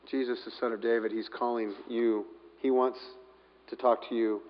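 very soft, low and tender; hushed, low reassurance; gentle encouragement. Acted. People telling the beggar Jesus calls, gentle encouragement.

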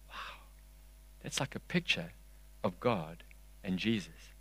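very soft, wondering murmur; acted moment of realisation; hushed awe. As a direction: breathe it out in quiet amazement. Acting a reader's awed realisation.

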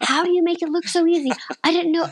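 imitating a high, rushed protest; mock exasperated outcry. Husband protesting to his aerialist wife, mock exasperated outcry. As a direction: pitch it way up in mock disbelief. Retelling husband's shocked complaint about pain.

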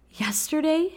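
high, rising disbelief; disbelieving exclamation. Retold or imitated. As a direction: echo the word in shocked disbelief. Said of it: "she exclaimed in disbelief".

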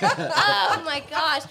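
high, slow, laughing oh my gosh. Real, not acted. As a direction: giggle it out. Laughing after the singing.

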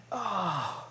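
soft, deflated mock moan; crestfallen.

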